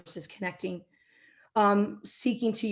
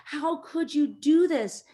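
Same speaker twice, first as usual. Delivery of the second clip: higher, slowed mock yell of an outraged old caller; retold angry scream; 8 semitones higher, 4 dB louder, slower than usual. Old woman caller berating the company president, mild mock yell. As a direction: imitate her shouting at the president, pitched up and drawn out. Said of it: "she is screaming at him".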